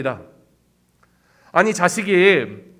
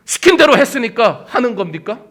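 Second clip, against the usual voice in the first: usual speaking voice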